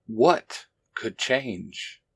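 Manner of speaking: ordinary delivery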